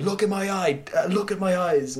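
high, strained mock outburst; acted confrontation. Accused man demanding his best friend face him, acted desperate outburst. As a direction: push the demand up high, insistently. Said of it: "he just confronts him".

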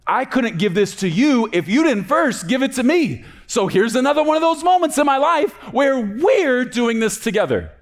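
loud, high, fervent prayer; impassioned declaration. Acted. Preacher voicing David as he gives to the Lord, impassioned. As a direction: cry it out to God at full force, high and insistent.

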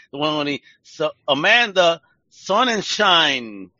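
slow, loud showman build-up to the name; announcer intro. Acted. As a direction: announce her like a stage emcee. Host announcing guest like emcee.

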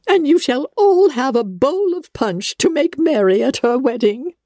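high, gushing, fluttering mother; a silly mother beside herself with delight; giddy mother rattling off wedding plans; Mrs Bennet, overjoyed. A narrator's reading. Narrator voices Mrs Bennet.

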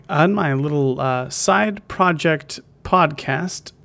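ordinary delivery

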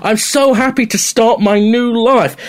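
high, loud gushing glee. Retold or imitated. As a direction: gush with giddy joy. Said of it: "and he's like".

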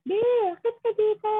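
high, fast mock shout to someone downstairs; imitated call upstairs. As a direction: call her up from below, mother-style. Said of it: "Yung talagang shouting to call someone downstairs".

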